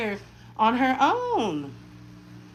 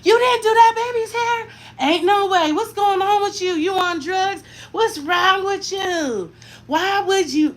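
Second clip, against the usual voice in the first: high, loud mock scolding; mock outrage at a mother who skipped her baby's hair; imitating scolding family; 9 semitones higher, 5 dB louder than usual. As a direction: play the outraged relative tearing into her. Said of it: "They'd have been like".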